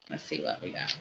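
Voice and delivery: breathy whisper while drawing a card; whisper to herself. Real, not acted. Tarot reader drawing a card for a viewer, a whisper. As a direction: whisper it, just for you.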